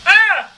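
high, loud startled cry; a sharp burst of surprise; sudden yelp. Real, not acted. Making lunch, a startled high yelp.

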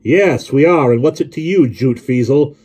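loud, high, combative shout; roaring. A narrator's reading. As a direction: roar back a challenge at the driver. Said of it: "Jonathan roared".

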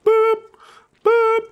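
two loud, high-pitched "boop" noises. Pretend. Gamer playing around, high boops. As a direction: pipe out little cartoon boops.

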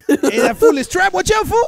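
loud, high yell of warning; sudden alarmed shout. Acted. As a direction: shout it out to warn him. Warning friend about explosion.

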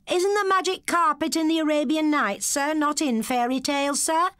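high, loud cheeky pupil; cheeky schoolboy. A narrator's reading. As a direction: needle the teacher innocently. Schoolboy voiced in story.

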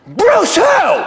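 high, incredulous shout; starstruck disbelief. Acted. Fan hearing a famous first name, starstruck yelp.

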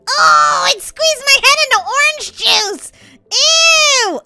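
high, loud squeal of disgust; grossed-out yell. Real, not acted. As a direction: wail it in playful horror. Head juiced in the game.

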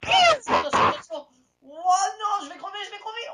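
very high alarmed shouting; panicking gamer. Real, not acted. Enemies closing in.

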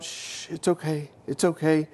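put-on soft shushing, as if to a crying baby; acted gentle comforting; soothing. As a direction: hush and reassure a baby.

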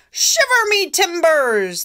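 loud, high pirate exclamation; pirate captain. Acted. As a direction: cry it out like an old pirate. Pirate captain voiced in story.

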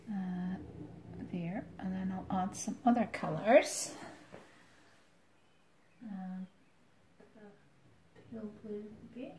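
fast whispered aside while drawing. Real, not acted. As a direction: murmur it to yourself, quick.

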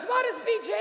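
high, puzzled mother voice; imitated mother. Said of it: "And she goes".

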